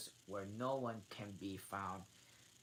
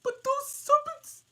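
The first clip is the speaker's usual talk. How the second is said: pigeon; 14 dB louder than usual. Acted.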